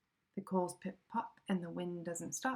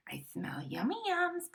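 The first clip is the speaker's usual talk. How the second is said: sniffing badger voice; 9 semitones higher than usual.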